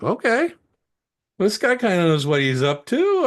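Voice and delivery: high, loud "Okay" of dawning admiration; impressed surprise. Retold or imitated. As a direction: sound suddenly impressed by the owner. Realizes the owner's clever draft.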